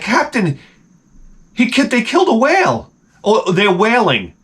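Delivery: high-pitched mock alarm; concerned crew. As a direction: imitate worried deckhands alerting the captain. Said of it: "everybody on deck was so concerned".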